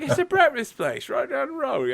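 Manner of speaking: high, slipping mock-Australian accent; imitated Australian concierge. Concierge directing guest down the road, high faked Australian. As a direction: explain it as a chirping Aussie clerk, badly faked. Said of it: "I like how your Australian went from".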